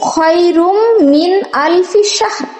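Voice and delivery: খয়রুম মিন আলফি শাহর joined in one breath, slow, no break. Shown as a demonstration. Teacher shows students how খয়রুম মিন আলফি শাহর flows in one breath. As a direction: link খয়রুম মিন আলফি শাহর smoothly without a pause. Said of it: "একটা আয়াত এক শ্বাসে, এক নিঃশ্বাসে পড়তে যাই".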